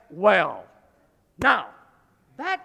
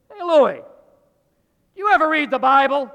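loud call; 5 semitones higher, 9 dB louder than usual. Retold or imitated. Friend calls out question.

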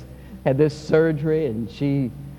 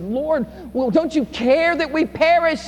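frightened plea; 11 semitones higher than usual. Acted. Disciples crying out in storm.

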